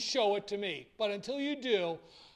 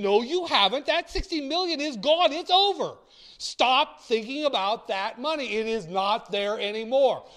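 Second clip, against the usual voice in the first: forceful rebuttal; 5 semitones higher, 7 dB louder than usual. Retold or imitated. Lecturer rebuking client.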